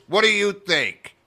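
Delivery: high, loud, slow challenge; acted angry demand. Club boss confronting a comic in her office, in acted anger. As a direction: hit it hard like an irate boss.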